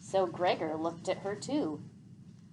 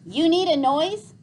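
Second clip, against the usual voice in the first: loud, raised frustrated question; 9 semitones higher, 10 dB louder than usual. A narrator's reading. Gregor answers Vicus while Boots kicks him, frustrated outburst. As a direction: throw the question back in exasperation. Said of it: "said Gregor in frustration".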